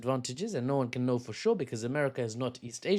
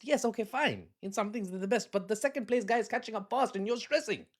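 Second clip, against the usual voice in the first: higher, exasperated concession; stressed-sounding; 8 semitones higher than usual. Real, not acted. Arguing back at a patriot friend, stressed-sounding. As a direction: concede it with rising agitation. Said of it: "It sounds like I'm stressing".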